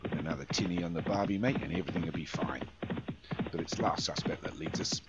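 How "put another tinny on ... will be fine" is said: a jokey Aussie mate's accent, only lightly voiced; Australian twang. Commentator consoling Doug like an Aussie mate, in a light Australian twang. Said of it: "giving it a bit of an Australian twang".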